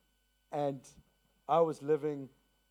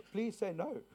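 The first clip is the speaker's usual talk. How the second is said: playful pleading; 6 semitones higher than usual. Real, not acted.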